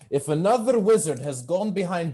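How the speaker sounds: loud, exasperated kitchen boss voice; head chef. Acted. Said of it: "He says".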